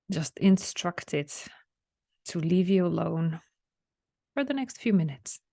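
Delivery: unhurried relaxation guide with long pauses; spacious, measured meditation lead; guided meditation. Real, not acted. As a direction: guide them gently inward, leaving room to breathe. Leads listeners through a relaxation meditation.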